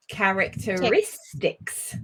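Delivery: "Characteristics" slow, with a clear final S. Shown as a demonstration. Teacher slowly models characteristics with the final S. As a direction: slowly sound every syllable and the S. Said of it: "don't forget the s on the end".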